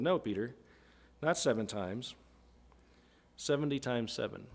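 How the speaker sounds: quiet Jesus. Retold or imitated. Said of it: "He said".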